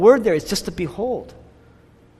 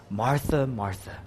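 emotional doublet; 5 semitones lower, 6 dB quieter than usual. Shown as a demonstration. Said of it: "intense emotional expression".

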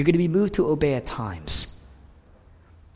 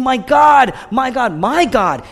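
high, loud cry through tears; loud, tearful plea; weeping cry; 10 semitones higher, 9 dB louder, slower than usual. Acted. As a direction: cry out to God in pain. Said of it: "Jesus is weeping".

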